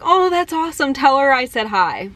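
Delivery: high, airy delight easing downward; pleased reply. Retold or imitated. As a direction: imitate a pleased star passing on a hello. Acts out [name]'s pleased reply.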